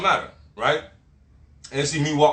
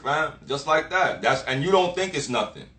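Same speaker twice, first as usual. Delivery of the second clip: ordinary talk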